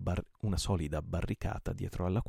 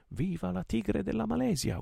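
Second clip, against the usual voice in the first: high, long-held cheer; yelled battle cry; 14 semitones higher than usual. A narrator's reading. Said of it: "urlò ancora Yanez". (urlo di battaglia acuto)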